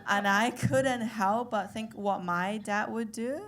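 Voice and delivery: ordinary talk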